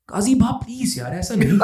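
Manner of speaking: Karachi code. Retold or imitated. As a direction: plead in street Karachi Urdu, half laughing. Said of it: "ये वाला code निकला था कराची वाला". (कराची वाले लहजे में मिन्नत की नक़ल)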